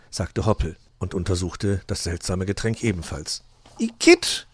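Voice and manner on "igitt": very high, loud yuck; high, loud, revolted cry; calling out in disgust. A narrator's reading. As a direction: squeal it in disgust. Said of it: "rief er dann".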